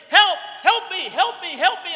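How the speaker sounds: high, loud, desperate cries; screamed plea for help. Retold or imitated. Drowning swimmer yelling to a friend, screamed plea for help. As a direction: cry out for help, pitched high and urgent. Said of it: "I'm screaming my lungs out".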